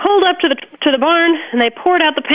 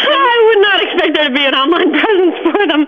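laughing; 5 semitones higher than usual. Real, not acted. Farmer reacting to the host's online search, laughing. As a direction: chuckle your way through it.

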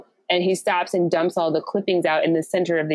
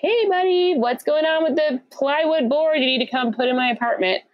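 fake friendly text voice; 8 semitones higher than usual. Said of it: "be like".

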